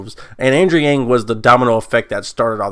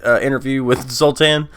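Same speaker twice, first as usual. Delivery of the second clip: usual speaking voice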